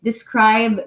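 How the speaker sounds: ordinary talk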